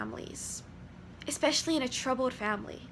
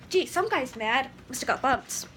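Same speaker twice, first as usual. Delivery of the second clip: distractor. Shown as a demonstration. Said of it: "A distractor".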